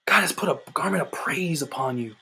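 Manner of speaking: hushed whisper of a promise. Real, not acted. Sudden hush in the sermon.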